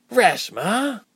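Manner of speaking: high-pitched, drawn-out rebuke; reproving, high exclamation; scolding. A narrator's reading. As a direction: scold a friend by name, pitched right up. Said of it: "Ilse scolded".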